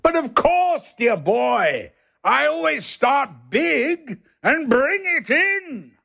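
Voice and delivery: plummy thespian voice, high and slow; Olivier impression. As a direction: ham up a lofty old stage actor saying dear boy. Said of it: "Larry goes".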